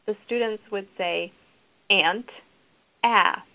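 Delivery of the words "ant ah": "Ant" firmly, pause, then its short-a "ah" alone; louder, separated "Ant" and its opening vowel "ah"; word then first sound. Shown as a demonstration. Students answer the teacher, "Ant", pause, isolated short-a "ah". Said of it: "students are taught to say".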